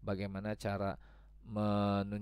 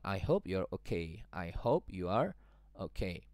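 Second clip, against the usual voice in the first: gentle sympathy; 3 semitones lower, slower than usual. Acted. Sympathetic reply slow.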